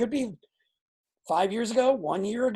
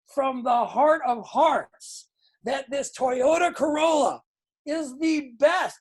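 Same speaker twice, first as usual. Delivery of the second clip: fired-up pitch, raised pitch with a shout in it; preacher-like sales pitch; 4 semitones higher than usual. Shown as a demonstration. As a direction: preach the Toyota Corolla like a revival. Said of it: "You're selling an emotion".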